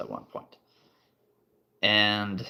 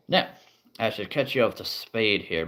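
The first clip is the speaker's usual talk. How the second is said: ordinary talk, nothing marked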